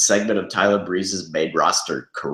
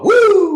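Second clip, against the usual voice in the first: piercing very high, loud wrestling woo; Flair woo; 8 dB louder than usual. Retold or imitated. Cohost echoing the Ric Flair ad, shrill loud woo.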